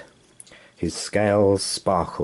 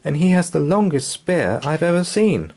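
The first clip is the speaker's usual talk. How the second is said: put-on child voice; 9 semitones higher, 3 dB louder than usual. Son's voice.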